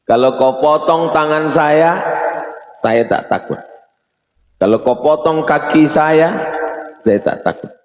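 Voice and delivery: high, forceful declaration dropping at each refusal; defiant quote. Acted. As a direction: act out a fearless zealot challenging his punishers. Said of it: "Kata siapa itu?".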